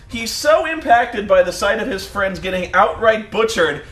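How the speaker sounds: normal talking voice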